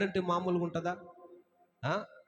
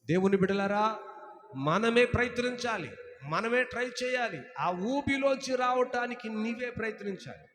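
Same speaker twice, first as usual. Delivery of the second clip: full-force preaching; 4 semitones higher than usual. Acted. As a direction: proclaim it from the pulpit. Preacher exhorts congregation. (గట్టిగా బోధించే స్వరం)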